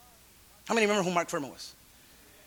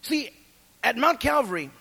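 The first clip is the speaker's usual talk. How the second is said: ordinary talk, nothing marked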